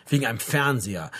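usual speaking voice

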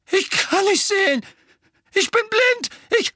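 high, loud terrified wail; a boy crying out that he's blind; panicked cry. A narrator's reading. Dudley, blinded in the dark, wails in panic.

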